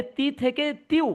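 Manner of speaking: তিউ with ti and the w glide both audible; both letters sounded. Shown as a demonstration. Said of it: "দুটো হরফের কিন্তু উচ্চারণ আসতে হবে". (তিউ, দুই হরফের উচ্চারণ স্পষ্ট করে)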